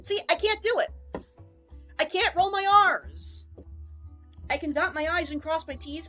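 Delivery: normal talking voice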